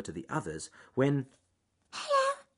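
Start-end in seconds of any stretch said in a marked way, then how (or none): 1.9-2.4 extremely high, tiny squeak; tiny wheezy voice. A narrator's reading. Leaf greeting the rabbit, in a tiny wheezy voice. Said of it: "said the leaf in a tiny, wheezy voice".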